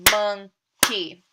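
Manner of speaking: "monkey" drawn out slowly, one clap per syllable; slow clapping demo of "monkey"; slow clapped syllables. Shown as a demonstration. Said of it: "I'll say the word again while clapping".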